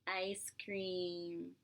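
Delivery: plain reading voice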